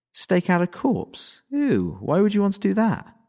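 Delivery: high, slow, grossed-out repeat; horrified incredulous echo; disgusted disbelief. Acted.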